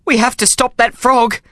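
high, frightened plea; trembling voice. A narrator's reading. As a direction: urge it with a tremor of fear. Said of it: "I said in a trembling voice".

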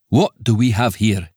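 thick Scots accent, lightly voiced; a Scots official sizing up strangers; Scots magistrate. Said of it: "The accent was thick Scots".

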